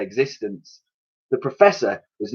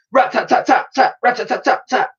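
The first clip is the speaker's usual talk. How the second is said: machine gun rattle; 12 semitones higher than usual. A narrator's reading. Machine gun sound narrated.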